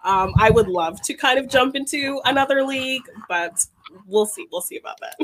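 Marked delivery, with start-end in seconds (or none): 4.5-5.1 whispered aside under the breath. Real, not acted. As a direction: breathe it like a private doubt. Self-doubting aside.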